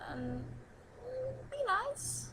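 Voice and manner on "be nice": squeaky. Acted. Sudden high far voice.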